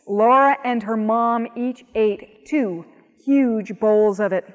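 ordinary delivery, nothing marked

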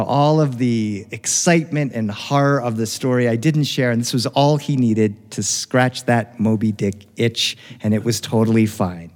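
ordinary delivery

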